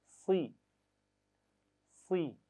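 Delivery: सी with lips drawn down; heavy full sound. Shown as a demonstration. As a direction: give सी a thick heavy sound. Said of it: "आवाज़ को मोटा भारी रखते हुए".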